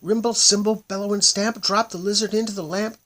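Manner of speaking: high, loud frantic chant; frantic spell. A narrator's reading. Hinchati desperately trying to stop the magic, frantic. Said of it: "And she burst into tears".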